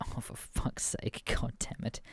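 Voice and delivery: hushed whisper of dismay; whispered curse. Retold or imitated. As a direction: whisper it so the kid can't hear. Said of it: "And we're like".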